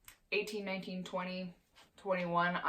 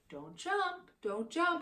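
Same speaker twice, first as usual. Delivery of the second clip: much higher, gentle sing-song correction; telling dog off gently; 11 semitones higher, slower than usual. Shown as a demonstration. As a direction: coax the dog down, kindly. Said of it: "you put him down and you say".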